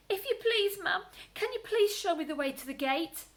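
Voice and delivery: high polite small voice; polite bunny. Acted. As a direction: ask very politely. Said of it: "he asked".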